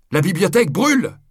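high, loud cry of alarm; alarmed shout. A narrator's reading. Monk raises the alarm of fire.

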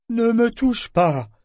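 high and loud, slow, sudden alarm; sudden sharp cry. Acted. Figurehead recoiling from Amber's touch, sudden high loud cry. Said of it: "dit-il tout à coup".

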